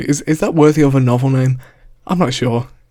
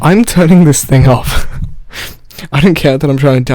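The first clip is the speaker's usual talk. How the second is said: annoyed; 8 dB louder than usual. Real, not acted. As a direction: raise voice over fan noise. Fed up with loud laptop.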